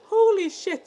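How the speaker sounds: sudden excited exclamation; holy shit. Retold or imitated. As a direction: blurt it out high and loud.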